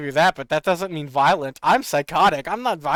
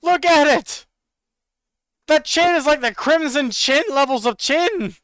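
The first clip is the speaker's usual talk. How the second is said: indignant excitement; 7 semitones higher than usual. Real, not acted. Defending the elf's chin, indignant.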